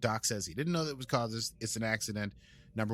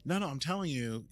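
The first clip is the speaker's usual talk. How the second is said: ordinary delivery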